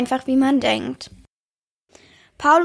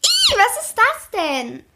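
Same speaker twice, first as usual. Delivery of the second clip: high, startled shriek of disgust; girl shrieking at a bug in class; shrill scream; 11 semitones higher, slower than usual. A narrator's reading. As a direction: squeal it out in fright. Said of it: "stieß … einen spitzen Schrei aus".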